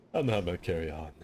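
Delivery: fast put-on character voice; cartoon voice. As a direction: rush it in a goofy voice. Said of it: "My voice is getting, like, a little too fried".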